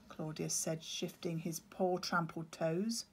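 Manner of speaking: plain reading voice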